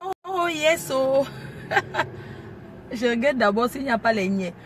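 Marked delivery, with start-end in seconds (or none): 1.3-2.3 airy, soundless giggling sob; breathless laugh-cry. Real, not acted. Driver giggling breathlessly at the wheel.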